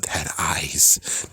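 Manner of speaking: hushed, breathless whisper of remembered panic. Retold or imitated. Recalling her psychotic break to the viewers, eerie whisper. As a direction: whisper it like the eyes might hear you.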